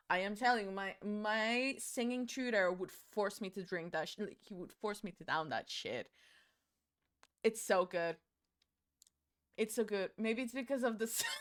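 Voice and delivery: ordinary talk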